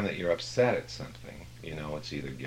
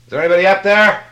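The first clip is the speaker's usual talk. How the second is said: shouted high cry upward; calling out; 21 dB louder, faster than usual. Retold or imitated. Climber dangling from a bush, shouting high up to the sky. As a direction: yell up the cliff face for help. Joke climber calls up the cliff.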